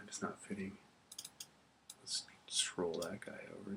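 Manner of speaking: soft under-the-breath whisper to herself; hushed thinking-aloud whisper; whispered aside. Real, not acted. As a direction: mutter it quietly while working the mouse. Teacher mutters to self at computer.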